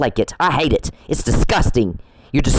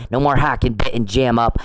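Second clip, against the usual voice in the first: normal talking voice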